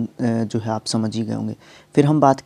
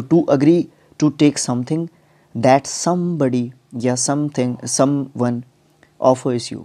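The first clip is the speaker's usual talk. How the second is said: slow, careful reading of an English definition; measured classroom dictation; much slower than usual. Shown as a demonstration. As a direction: read it out slowly so students can note it down. Teacher reads definition aloud.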